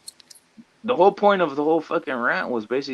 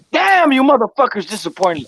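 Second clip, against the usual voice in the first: high, loud retold dismay; acted-out cry of letdown; disappointed outburst; 9 semitones higher, 7 dB louder than usual. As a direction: blurt it out in exasperated disappointment. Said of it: "I was disappointed".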